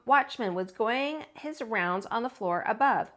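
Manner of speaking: plain reading voice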